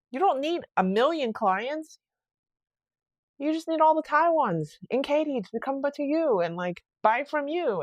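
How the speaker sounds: rally her with conviction; lightly retold pep talk; encouraging. Pep-talking the friend about customers.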